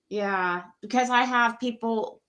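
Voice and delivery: ordinary talk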